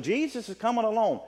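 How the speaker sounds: ordinary talk, nothing marked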